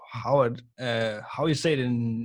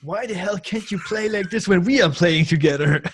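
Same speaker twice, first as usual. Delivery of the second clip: mock indignant friend; 6 semitones higher, 6 dB louder than usual. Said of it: "wrote me like".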